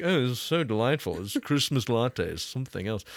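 put-on snooty connoisseur, lightly played; mock snooty. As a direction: pretend to be a latte snob. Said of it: "be snooty and be like".

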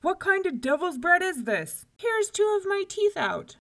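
high, loud outrage; shouting. A narrator's reading. Giant complaining of broken teeth, shouting. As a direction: holler like a hurt giant. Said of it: "shouted Cuchulainn".

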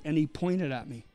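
ordinary talk, nothing marked

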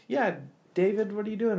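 high-pitched imitation of the sister; lightly voiced sister calling out; high mock sister voice. Sister character in retold scene.

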